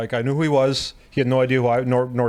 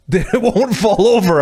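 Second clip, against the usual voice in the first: laughing while quoting, higher and louder; laughing, slowed quote of the coach; laughing through quote; 7 semitones higher, 7 dB louder, slower than usual. Retold or imitated.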